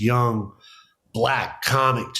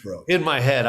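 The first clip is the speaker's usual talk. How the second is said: normal talking voice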